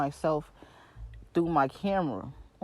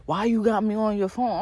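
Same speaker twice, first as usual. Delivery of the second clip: higher-pitched imitation of yelling; mild mock yell; 5 semitones higher, 4 dB louder than usual. Stylist scolding client like a little kid, mock yelling. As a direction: retell it as a light scolding yell. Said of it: "yelling at me".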